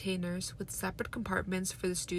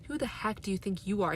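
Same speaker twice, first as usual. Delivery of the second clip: usual speaking voice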